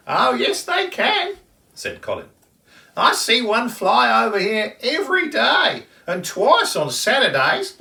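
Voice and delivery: high, loud teasing bird voice; cheeky kookaburra. Acted. Kookaburra teasing the kangaroo, high and loud. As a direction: tease him in a bright high birdy voice.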